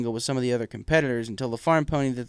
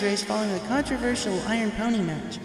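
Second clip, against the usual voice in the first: put-on baby dragon announcer; 10 semitones higher than usual. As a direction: welcome the whole crowd like a young dragon. Spike commentating the race.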